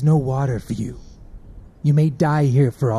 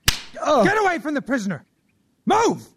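high, sharp shouted order; shouting; 11 semitones higher than usual. Acted. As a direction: yell him away from the prisoner. Guard orders man away.

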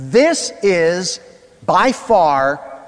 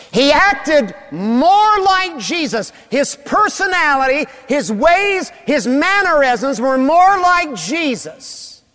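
higher, louder and slow, hammering "more"; preaching at full force; 10 semitones higher, 3 dB louder, slower than usual. Acted. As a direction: build to the climax of the sermon. Preacher builds to climax.

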